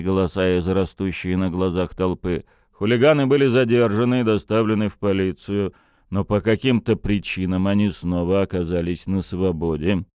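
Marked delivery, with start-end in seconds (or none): none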